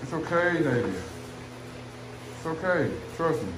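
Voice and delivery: softly soothing a worried dog; soothing reassurance. Real, not acted. Owner softly soothes a worried dog. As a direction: hush and calm him down gently.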